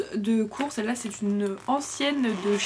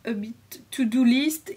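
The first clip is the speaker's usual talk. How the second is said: slow English words in a heavy French accent; self-conscious French accent on English labels; slower than usual. Real, not acted. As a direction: read the English headings with a clumsy French accent, carefully. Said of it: "désolée pour mon accent qui est pourri".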